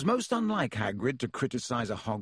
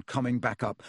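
ordinary delivery

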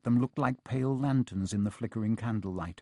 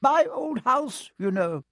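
much higher, slow and fond; jolly booming friar; 13 semitones higher, 4 dB louder, slower than usual. A narrator's reading. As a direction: say it with proud nostalgia. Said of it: "said the friar".

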